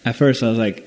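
ordinary talk

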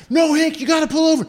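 frantic friend in the back seat; panicked plea. Retold or imitated. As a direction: squeal at him to pull over. Friends pleading with the driver.

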